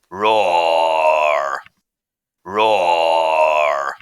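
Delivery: low, loud, drawn-out roar; loud bear roar. Acted. Sound effect played back for the class, loud low roar. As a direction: give a big hungry-bear roar. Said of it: "I'm just having a bit of fun with this".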